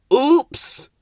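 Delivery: high squeaky yelp; little startled cry; dismayed oops. A narrator's reading. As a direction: blurt it in alarm. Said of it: "went Bill and Whimsy".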